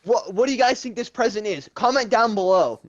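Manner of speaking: high upbeat YouTuber pitch. Retold or imitated. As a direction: sell it to the camera. Parodying YouTuber outro.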